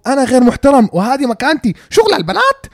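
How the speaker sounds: loud, incredulous retort climbing very high at the end; mock indignant disbelief. Reacting to her insulting voice note, loud indignant disbelief. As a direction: repeat the insult in theatrical outrage.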